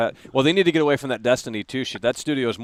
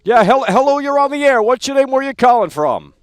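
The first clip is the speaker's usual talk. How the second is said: loud radio host; 9 semitones higher, 7 dB louder than usual. Real, not acted. Shouting greeting at caller.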